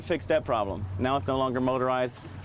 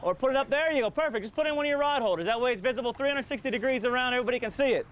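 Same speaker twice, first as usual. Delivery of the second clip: calling out; 11 semitones higher than usual. Real, not acted. Calling across to another boat.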